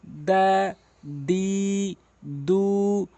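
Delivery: "দা, দি, দু" held long and level; even, chant-like long vowels on "দা, দি, দু"; syllable reading drill. Shown as a demonstration. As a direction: recite "দা, দি, দু" one by one. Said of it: "আপনি দ্রুত উচ্চারণ করবেন".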